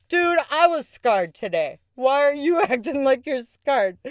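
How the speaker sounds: high, half-laughing comeback; retold indignant protest. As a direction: throw the complaint right back, loudly. Acting out her retort to him.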